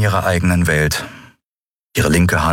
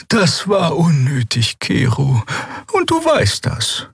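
high, slow scolding; reproachful; 11 semitones higher, slower than usual. A narrator's reading. Crewmate scolding Kero for needling the captain, reproachful. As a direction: draw out the reproach.

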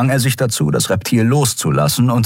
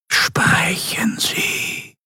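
soft, slow remote voice; distant cold voice; 4 dB quieter, much slower than usual. Acted. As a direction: speak coldly from afar. Governor over transmission. (kühle, ferne Stimme)

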